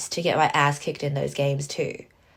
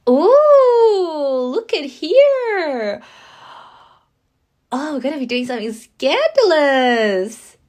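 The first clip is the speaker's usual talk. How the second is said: much higher, louder, slow mocking tease; high teasing sing-song; 8 semitones higher, 9 dB louder, slower than usual. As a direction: coo it like a scandalized gossip.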